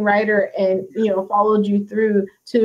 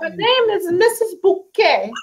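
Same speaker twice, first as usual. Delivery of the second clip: higher-pitched, lightly put-on sitcom lady; Missus Bouquet; 7 semitones higher than usual. Fan quotes the sitcom lady correcting her name, lightly mimicked. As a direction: insist on the name, imitating her. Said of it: "she didn't like you to call her".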